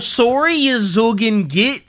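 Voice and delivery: high, loud Canadian orc; drawn-out mock-Canadian apology with a laugh in it; Canadian accent. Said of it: "but in a Canadian accent".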